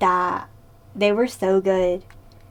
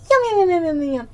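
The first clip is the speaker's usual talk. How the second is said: excited yum; 7 semitones higher, much faster than usual. Real, not acted. Praising canned peaches.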